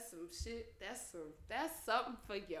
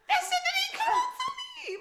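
exasperated shout; 5 dB louder than usual. Real, not acted. Loud exasperated outburst.

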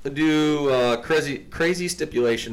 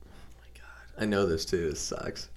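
whispered groan of frustration; frustrated whisper; 3 semitones lower, 9 dB quieter than usual. Real, not acted. Stumped player whispers to himself in frustration. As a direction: grumble it under your breath.

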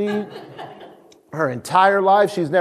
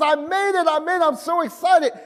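excited daughter; 8 semitones higher than usual. Retold or imitated. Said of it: "She goes".

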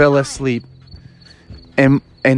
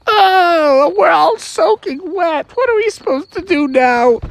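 put-on higher childlike voice; soaked kids; 13 semitones higher than usual. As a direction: whine like wet little kids. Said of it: "the kids were like".

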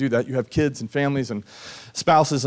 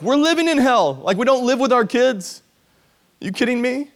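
much higher, louder, incredulous cry; exasperated outcry; 11 semitones higher, 5 dB louder than usual. Retold or imitated. Parents living apart from their kids, a high incredulous outcry. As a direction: throw it out in disbelief.

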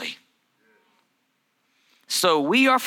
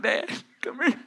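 put-on laughing through a small child's plea; half-laughing kid tugging dad; timid child, laughing; 6 semitones higher, 4 dB quieter than usual. Boy leading dad to the stain, laughing. Crying child takes dad's hand.

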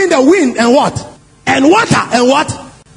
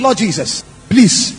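low pleading; 8 semitones lower, slower than usual. Acted. Frightened townspeople begging Jesus to leave.

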